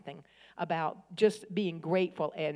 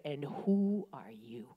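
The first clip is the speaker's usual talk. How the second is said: hushed divine reprimand to an angry woman; hushed Holy Spirit rebuke; 6 semitones lower, 7 dB quieter than usual. Retold or imitated. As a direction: whisper it like a holy rebuke from above. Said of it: "and he said".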